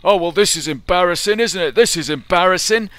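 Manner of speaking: loud, high-pitched mock complaint; imitated displeasure. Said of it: "to vocalise his displeasure".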